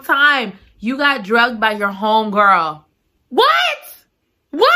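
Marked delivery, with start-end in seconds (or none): 3.3-4.7 high, shocked squeal; stunned high squeal of disbelief; shocked what. Real, not acted. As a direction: react in total disbelief. Shocked at the betrayal.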